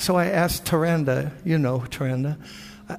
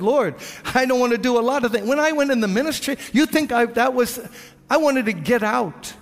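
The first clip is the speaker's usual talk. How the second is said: high, fast, urgent outburst; impassioned confession; 8 semitones higher than usual. Retold or imitated. Preacher confessing he wanted out of ministry, impassioned. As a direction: pour it out with raised pitch.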